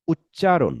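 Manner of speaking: উচ্চারণ with the push on the middle syllable চা; stress on চা. Shown as a demonstration. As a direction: lean hard on চা in উচ্চারণ. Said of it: "চায়ের উপরে কিন্তু আমি একটা প্রেশার দিয়েছি".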